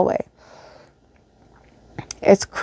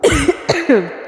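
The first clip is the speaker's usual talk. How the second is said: embarrassed laugh; 6 semitones higher than usual. Real, not acted. Reader breaks into laughter.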